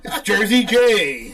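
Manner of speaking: drawn-out, loud, laughing; teasing nickname call. Real, not acted. Crowning a friend Jersey Jay, gleeful loud teasing.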